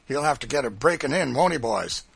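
rally henchmen with cruel relish; forceful boss's threat; blustering master. A narrator's reading. Legree addresses his drivers.

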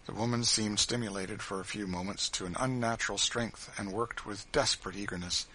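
ordinary delivery, nothing marked

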